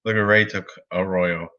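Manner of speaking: ordinary delivery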